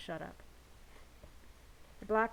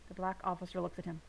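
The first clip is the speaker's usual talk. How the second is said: usual speaking voice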